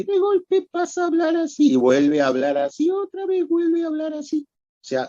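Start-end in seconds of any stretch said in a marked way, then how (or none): 0.0-1.7 sudden leap into very high-pitched voice; bitonal voice jump. Shown as a demonstration. Doctor demonstrating a bitonal patient's voice, suddenly very high. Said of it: "Voz bitonal quiere decir que". 2.9-4.5 very high-pitched second voice; bitonal voice, high tone. Shown as a demonstration. Doctor demonstrating a patient's bitonal voice, the high tone. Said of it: "tiene dos tonos de voz".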